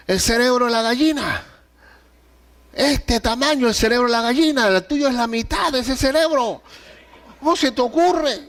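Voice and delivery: high, mock-stern dad telling off his kid; stern father scolding. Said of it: "a veces me decía cosas que". (padre regañando a su hijo, en tono alto y burlón)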